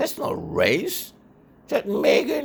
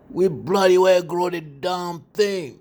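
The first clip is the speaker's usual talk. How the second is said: much higher and louder, indignant; outraged shout; 13 semitones higher, 6 dB louder than usual. Acted. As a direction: blast it out in offended fury. Outraged reply about chocolate.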